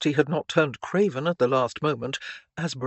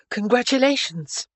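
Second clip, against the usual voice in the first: put-on high, louder, hearty girlish voice; congratulating Stephanie; 8 semitones higher, 5 dB louder than usual. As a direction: offer hearty praise in a raised pitch.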